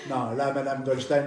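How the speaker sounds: ordinary talk, nothing marked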